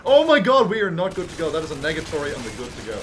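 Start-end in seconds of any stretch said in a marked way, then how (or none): none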